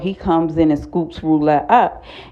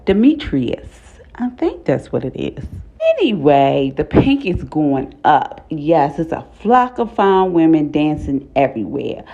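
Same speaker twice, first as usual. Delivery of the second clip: ordinary talk, nothing marked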